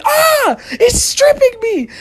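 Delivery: very high-pitched comic scream; panicked shriek. Acted. Victim with dog pulling shorts down, panicked shriek. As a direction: wail it in hammed-up terror.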